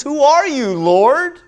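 high loud demanding cry. Acted. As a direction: call up to the light. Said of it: "He says".

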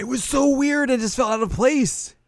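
very high-pitched disbelief; real, high-voiced incredulous outburst; baffled exclamation. Real, not acted. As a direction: squeal it out in bewilderment. Speaker's own baffled exclamation.

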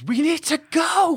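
high-pitched urgent scream; screamed urgency. A narrator's reading. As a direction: scream it like you're shaking someone into moving. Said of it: "grabs you by the shoulders and screams".

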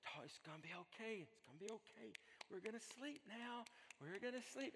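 soft, high parental cooing; soothing a baby. Acted. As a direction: gently hush a child to sleep. Parent soothing crying baby, acted.

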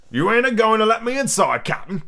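high pirate-voiced grumble; complaining. A narrator's reading. Long John asking to come inside, complaining. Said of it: "complained Long John".

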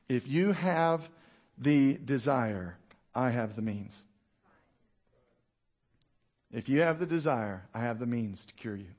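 a hint of God's gentle invitation; voicing God's words gently to the listener; the Lord speaking. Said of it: "here's what the Lord's saying".

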